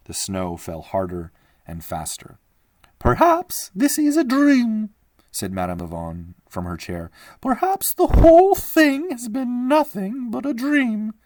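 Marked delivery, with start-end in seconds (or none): 3.0-4.9 much higher, slow, dreamy lady; a fragile old lady musing; old lady's voice. Acted. Madame Lavon from her chair. 7.4-11.1 much higher, slow, dreamy lady; a fragile old lady musing; old lady's voice. Acted. Madame Lavon from her chair.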